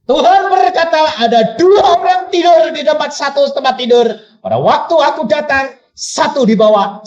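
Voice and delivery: high, loud proclamation of scripture; full-force preaching. Acted. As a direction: thunder it out to the congregation.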